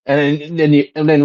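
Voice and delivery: ordinary delivery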